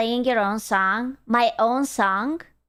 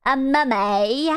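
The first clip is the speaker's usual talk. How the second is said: usual speaking voice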